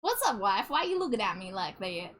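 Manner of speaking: playful Southern cowboy drawl; Southern yeehaw voice. Pretend. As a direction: lay on a Southern twang, with whoops. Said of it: "Oh, it's Southern voice".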